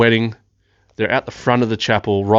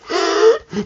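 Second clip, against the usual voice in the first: a sudden high astonished gasp; sharp gasp; 4 dB louder than usual. Retold or imitated. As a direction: suck in air as if stunned by the shot. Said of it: "And I was like".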